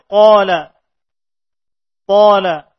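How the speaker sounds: QALA with a heavy, dark aa like "aw"; thick alif. Shown as a demonstration. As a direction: say qala loud, rounding the long vowel full and thick. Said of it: "maka alif mengikuti huruf tersebut tebalnya".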